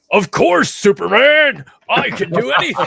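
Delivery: booming, high, loud muscle-bound heroine; brolic Wonder Woman. Acted. Comic-book fan spoofing a miscast Wonder Woman actor, brolic. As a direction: bellow it like a brolic superhero. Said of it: "sounds really, like, brolic".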